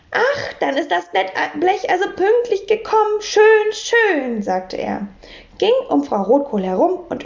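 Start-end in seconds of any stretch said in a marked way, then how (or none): 0.1-3.2 high, delighted voice; light up with pleasure, pitched right up; beaming. A narrator's reading. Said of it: "Herr Taschenbier strahlte". 3.2-4.4 high, delighted, falling in satisfaction; beaming. A narrator's reading. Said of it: "Herr Taschenbier strahlte".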